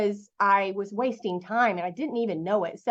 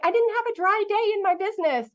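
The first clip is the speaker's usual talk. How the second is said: much higher, bright burst of gratitude; grateful excitement; 10 semitones higher than usual. Retold or imitated. After her first big sale, pitched high with grateful excitement. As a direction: let the thankfulness lift the pitch. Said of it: "I was so grateful".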